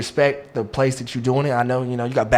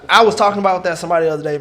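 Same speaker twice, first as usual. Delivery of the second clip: ordinary talk, nothing marked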